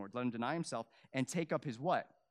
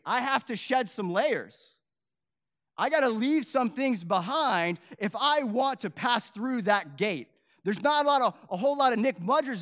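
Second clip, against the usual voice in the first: loud, driving sermon build; rising to a shout from the pulpit; fiery preaching; 7 semitones higher, 11 dB louder than usual. Real, not acted. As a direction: preach it hard to the back row. Preaching builds to full force.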